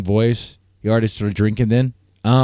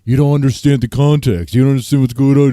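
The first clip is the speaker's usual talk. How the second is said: louder, pushy mock friend voice; mocking Jeremy; 6 dB louder, faster than usual. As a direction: parody his irritated protest. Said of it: "and be like".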